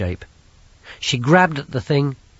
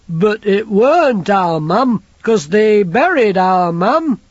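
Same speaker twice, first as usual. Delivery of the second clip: put-on high, slow, childlike big lad; simple lad voice; 10 semitones higher, 9 dB louder, slower than usual. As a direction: voice him like a big simple boy. Banjo's voice, slower.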